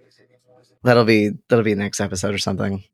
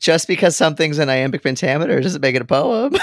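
laughing; 5 semitones higher, faster than usual. Real, not acted. Laughing while making a point.

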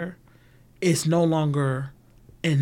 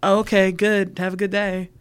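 higher, louder chirpy passing check-in; breezy acquaintance; 5 semitones higher, 5 dB louder than usual. Retold or imitated. Acquaintance asks in passing, higher and louder chirpy voice. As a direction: toss it off brightly and move on. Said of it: "the acquaintance can come up and say".

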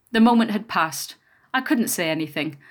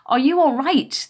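higher, louder anxious question; concerned; 7 semitones higher, 6 dB louder than usual. A narrator's reading. Passing woman helping a child up, concerned. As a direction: ask with raised pitch and concern.

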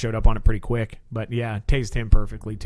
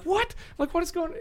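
much higher, softer baffled question; surprised; 7 dB quieter than usual. Retold or imitated. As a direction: sound totally thrown. Reacting to sudden yell.

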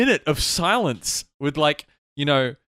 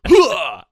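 tennis grunt; 6 semitones higher, 10 dB louder than usual. Retold or imitated. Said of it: "see Tom Cruise get thrown ... and go".

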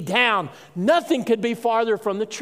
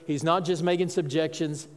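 usual speaking voice

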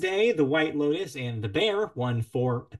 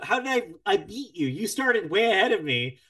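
mock dismay; 8 semitones higher, 6 dB louder than usual. Real, not acted. Friend finished show first.

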